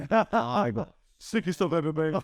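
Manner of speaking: laughing through the line, slow and high. Real, not acted. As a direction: tease him while laughing. Teasing about Ben's illness.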